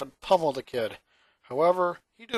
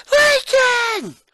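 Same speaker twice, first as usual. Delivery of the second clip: very high, loud yell of his name; angry little girl's yell; shrill yell; 10 dB louder than usual. A narrator's reading. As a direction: yell it across the hall. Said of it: "a shrill voice yelled out".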